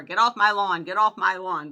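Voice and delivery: loud, hammed-up grumpy yelling; mock yell. Elderly dog yells out the front door, loud mock yell. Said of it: "she yells out the front door".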